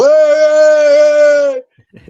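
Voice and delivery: high, loud mock crowd chant; imitating fans shouting at the wrestlers; crowd yelling. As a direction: call out like a mob of excited fans. Fans calling out at the doors.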